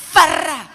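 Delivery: loud, high rolled-r whoosh; explosive "farra" sound effect; imitated sandal throw. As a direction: sound out a flung sandal, loudly.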